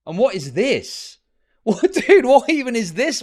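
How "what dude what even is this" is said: high, loud, laughing bafflement; laughing disbelief. Real, not acted. Streamer reacting to a baffling picture, laughing in disbelief. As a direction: blurt it out through real laughter.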